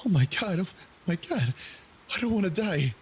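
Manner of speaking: retold desperate scream; terrified pleading. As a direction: beg not to die in terror. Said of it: "while screaming".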